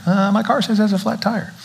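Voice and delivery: light imitation of wife reporting a flat; quoting Cheryl. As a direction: pitch up to mimic Cheryl's worried report. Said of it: "She's like".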